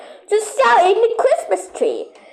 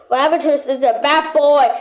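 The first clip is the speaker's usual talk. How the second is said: ordinary delivery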